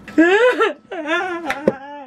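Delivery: high, exaggerated wailing sob; wailing sob demo. Shown as a demonstration. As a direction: show off the big ugly cry. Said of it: "let me show you people what I look like".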